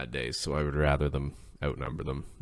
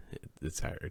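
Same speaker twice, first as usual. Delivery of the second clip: breathy, much softer whisper; whispered admission; 10 dB quieter than usual. Real, not acted. Admitting work is hard, a soft whisper. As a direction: let it out as a half-whisper.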